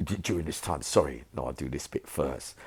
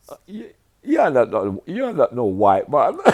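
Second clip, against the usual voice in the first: Escobar in disbelief; 9 semitones higher, 10 dB louder than usual. Retold or imitated. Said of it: "this is Escobar to me".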